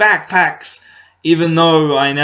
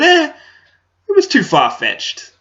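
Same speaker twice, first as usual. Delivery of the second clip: much higher dismissive mock-dad voice; imitating dad; 13 semitones higher, 3 dB louder than usual. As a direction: lampoon dad waving the movie away.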